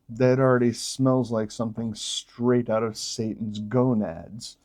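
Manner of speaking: normal talking voice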